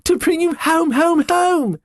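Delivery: put-on very high, singsong child repeating home; gleeful little girl. Fan calling her brother home, very high and gleeful. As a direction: bounce with glee. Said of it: "clapping her tiny hands".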